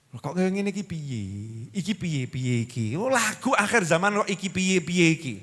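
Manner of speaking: soft, singsong lament; hammed-up helpless grumbling; dizzy with worry. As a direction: moan it like someone at a loss. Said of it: "Menggambarkan mumetnya orang-orang".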